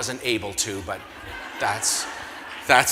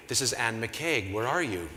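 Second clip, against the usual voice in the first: imitated caller, low, slow and soft; Anne McCaig; 4 semitones lower, 6 dB quieter than usual. Gala boss asks where he is, low slow voice. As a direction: play the boss on the line, low and measured. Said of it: "this voice says".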